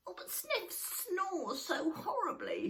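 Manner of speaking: slow, high little troll whine; high child voice. Acted. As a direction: complain like a small child.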